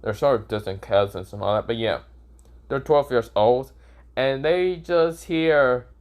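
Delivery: ordinary delivery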